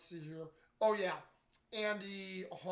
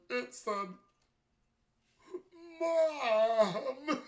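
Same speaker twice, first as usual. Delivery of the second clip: mock sob breaking into laughter; louder and higher, sobbing laugh on Mom; crying and laughing; 7 semitones higher, 6 dB louder, much slower than usual. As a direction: imitate him crying and cracking up at once. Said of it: "crying and cracking up at the same time".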